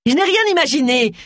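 high-pitched, indignant retort; angry roar. A narrator's reading. Agatha rejects a fisherman's accusation, roaring indignantly. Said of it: "rugit Agatha".

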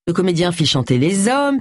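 plain reading voice

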